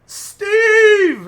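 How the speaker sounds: high, loud, drawn-out call; full-voiced long shout from far away; yelled name. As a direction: holler it across a noisy casino.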